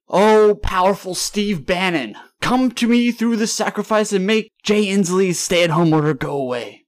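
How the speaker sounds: high, loud chant to a pagan god; mock invocation. Driver praying to a pagan god for lifted orders, mock invocation. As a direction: call out grandly as if summoning a deity.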